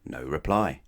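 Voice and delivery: usual speaking voice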